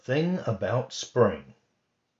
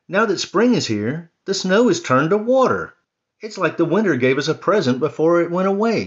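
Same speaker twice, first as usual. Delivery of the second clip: higher, louder, quick and thrilled; delighted Mouse; 8 semitones higher, 9 dB louder, faster than usual. Acted. Mouse delighting in the melted snow, higher excited voice. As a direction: bubble over with joy.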